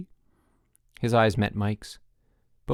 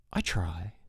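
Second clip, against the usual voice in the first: low, soft, modest reply; 4 semitones lower, 6 dB quieter than usual. A narrator's reading. Rudy answers Harold's blame, modestly. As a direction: say it small and quiet. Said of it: "said Rudy modestly".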